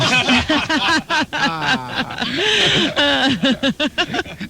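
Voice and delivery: squealing laugh at his own joke; real high-pitched laughter; high burst of laughter. Real, not acted.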